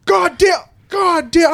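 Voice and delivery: high-pitched imitation of an angry outburst; loud anger. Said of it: "Super loud".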